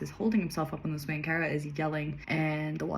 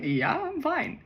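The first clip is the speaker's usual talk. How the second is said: Sophie replying, high; 10 semitones higher, slower than usual. Acted. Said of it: "Sophie's like".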